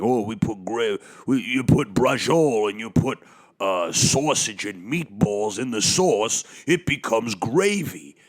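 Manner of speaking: slow, mocking put-on voice; mock know-it-all. Mocking relatives who call sauce gravy, slow smug voice.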